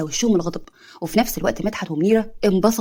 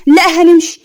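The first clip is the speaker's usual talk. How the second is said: higher and louder, a huffy refusal; offended outburst; 7 semitones higher, 7 dB louder, slower than usual. Acted. Aunt refuses to stay after the quarrel, loud huffy refusal. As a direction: snap it back, we're leaving.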